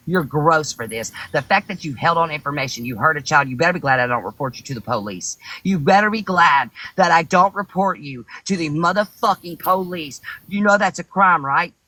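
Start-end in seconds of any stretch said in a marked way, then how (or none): 5.6-11.7 angry shout. Real, not acted. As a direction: yell the threat at him. Threatening to call police.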